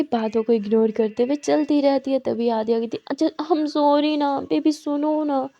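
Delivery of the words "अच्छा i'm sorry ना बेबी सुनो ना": slow pleading. Acted. Pleading with angry friend.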